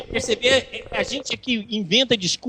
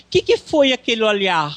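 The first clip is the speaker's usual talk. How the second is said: mock hurt question; 2 semitones higher than usual. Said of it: "Você fala assim".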